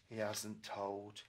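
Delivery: usual speaking voice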